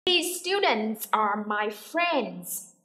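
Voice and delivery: very slow, word-by-word sentence; drawn-out, careful teacher's delivery; repeat-after-me model. Shown as a demonstration. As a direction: model it slowly for learners to copy. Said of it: "listen and repeat after me".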